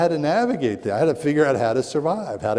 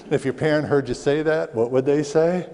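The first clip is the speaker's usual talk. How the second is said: ordinary talk, nothing marked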